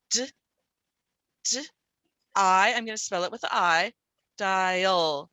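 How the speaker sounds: D, D, I said apart then dial; sounding out dial. Shown as a demonstration. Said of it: "what are the sounds you hear".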